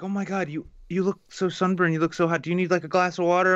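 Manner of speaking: a hint of a fussing friend; imitating Lacey. Imitating Lacey fussing over his sunburned-looking face. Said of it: "she's like".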